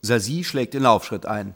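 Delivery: usual speaking voice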